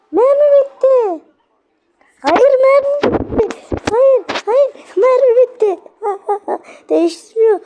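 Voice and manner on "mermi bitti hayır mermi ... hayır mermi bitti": high, slow, panicked wail over the empty gun; panicked cry. Real, not acted. Gamer out of ammo as the monster nears, panicked wail.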